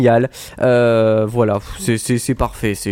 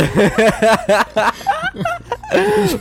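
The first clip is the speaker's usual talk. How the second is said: long, high-pitched burst of laughter; real giggling fit; laughing fit. Real, not acted. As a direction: crack up helplessly, climbing high. Laughing fit at risqué remark.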